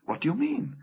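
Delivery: put-on high, mildly alarmed girl; rather frightened. A narrator's reading. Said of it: "feeling rather frightened".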